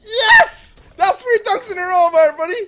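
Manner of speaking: high, loud victory shout; triumphant shouting. Real, not acted. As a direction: crow it to everyone. Celebrating three dunks in row.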